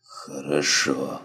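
very slow, soft, drawn-out Хорошо; dying man's slow hushed okay. Acted. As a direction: say Хорошо faintly and slowly, dying. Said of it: "констатировал оперативник".

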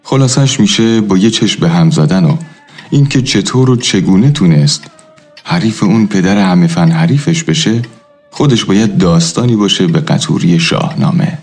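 ordinary talk

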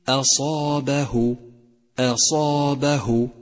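slow أصابه, thin أ then heavy ص; light hamza. Shown as a demonstration. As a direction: recite أصابه keeping أ light, ص full. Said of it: "فرقق الهمزة وفخم الصاد". (ترتيل أصابه بترقيق الهمزة وتفخيم الصاد)

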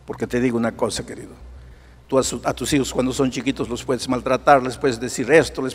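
quiet heartfelt sermon over soft music; intimate preaching. Acted. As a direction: lean in close and counsel gently.

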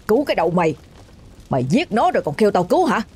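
mildly angry scornful father; restrained harsh scolding; angry rebuff. A narrator's reading. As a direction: throw the plea back at him. Father punches and rebuffs son.

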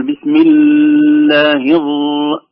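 slow mistaken take lingering on the ل of "الله"; overheld doubled letters. Shown as a demonstration. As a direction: chant "بسم الله الر-" with the doubled sounds dragged. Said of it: "لا نقول". (مط الحروف المشددة في "بسم الله")

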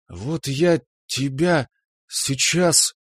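slow, menacing warning; slow threat. Acted. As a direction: draw it out with menace. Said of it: "медленно, с угрозой проговорила жена". (медленно, с угрозой)

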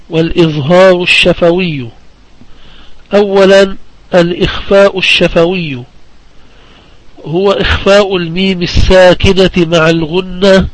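ordinary delivery

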